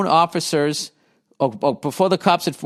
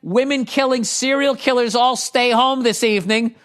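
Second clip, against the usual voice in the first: mock cop announcement; 8 semitones higher than usual. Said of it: "if the cops could say".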